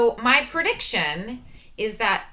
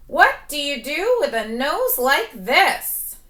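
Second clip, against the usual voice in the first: excited read; 6 semitones higher, 6 dB louder than usual. Acted. Reading book aloud with excitement.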